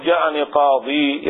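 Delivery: "قاضي" slow, ending in long ee; pause with ya. Shown as a demonstration. Teacher showing a weaker permitted pause, with ya. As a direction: stretch the final ya of "قاضي". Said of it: "يجوز أن تقول".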